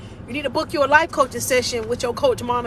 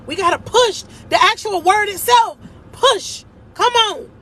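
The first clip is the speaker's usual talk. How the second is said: fervent preaching; 7 semitones higher than usual. Real, not acted. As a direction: drive the word push home. Preaching climax, urging push.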